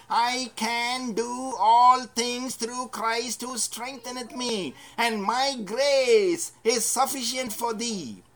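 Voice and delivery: slow, weighty proclamation of scripture; slow proclaimed verse. Acted. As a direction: spell out each word of the verse slowly and with weight. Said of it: "He knew this verse that said".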